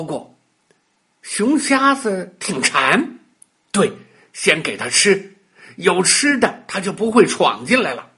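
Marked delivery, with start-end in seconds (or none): none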